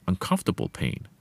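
normal talking voice

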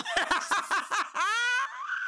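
high shrieking laugh. Real, not acted. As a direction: burst into a shrill laugh. Laughs at the message.

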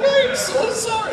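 nervous giggly sorry; apologizing through giggles; giggling apology. Retold or imitated. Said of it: "And I'm giggling and laughing".

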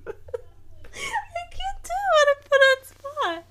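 high, squeaky words through real laughter; drawn out, nearly crying with laughter; helpless laughter. Real, not acted. Failing the accent test for partner, helplessly laughing. Cracking up after failed accent attempt.

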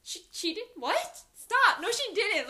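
hammed-up stunned denial; mock shock. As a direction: act floored by the news. Pretending to hear the news.

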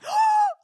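high sharp gasp of delight; excited gasp. Real, not acted. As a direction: gasp at the lucky roll. Rolled a natural twenty.

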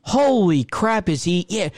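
usual speaking voice

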